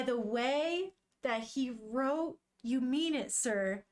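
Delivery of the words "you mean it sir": ordinary delivery, nothing marked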